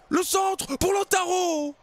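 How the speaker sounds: high, slow, shouted cross; drawn-out call. Acted. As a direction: stretch the call out. Cross called out.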